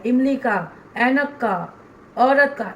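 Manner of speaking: each letter read as its picture word, इमली का, ऐनक का, औरत का; teacher showing how children should not read letters; wrong way, letter with picture word. Shown as a demonstration. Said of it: "ऐसे वर्ण नहीं पढ़ना है".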